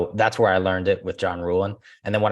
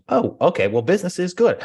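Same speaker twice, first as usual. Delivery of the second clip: higher, upbeat casual answer; breezy reply; 10 semitones higher, 4 dB louder than usual. Retold or imitated. Agent answering a client's question, higher and upbeat. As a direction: toss it off cheerfully. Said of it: "And then that becomes a more organic conversation".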